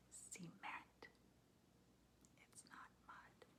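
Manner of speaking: very soft, voiceless whisper; whispered aside. Real, not acted. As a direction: whisper it as a secret to the listener. Whispered aside reading a sign.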